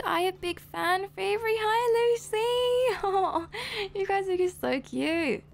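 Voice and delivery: high, slow delighted cooing; sing-out high, stretched affectionate delight; gushing. Real, not acted. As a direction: greet a fan with a melting squeal. Reads fan message, greets her, coos.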